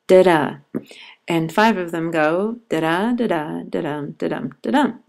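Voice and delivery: da-DUM, second syllable stressed; iambic beat. Shown as a demonstration. Teacher demonstrating an iamb, da-DUM with the second beat stressed. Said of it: "one iamb would be a".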